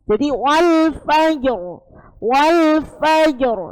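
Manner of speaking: "fajr" with the r kept heavy and clear. Shown as a demonstration. Tajwid teacher modelling to the class, heavy r drawn out. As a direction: say "wal fajr" slowly, r rolled thick. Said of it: "tebal, tebal".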